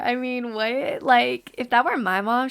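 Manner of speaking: usual speaking voice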